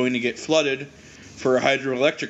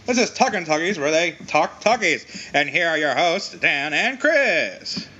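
put-on old radio announcer; 3 semitones higher than usual. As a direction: stretch it out like a vintage radio host. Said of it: "old-timey radio announcer".

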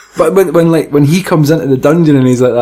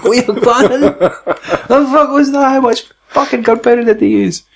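incredulous; 8 semitones higher than usual. Real, not acted. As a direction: stretch it out in disbelief. Incredulous at film explosion.

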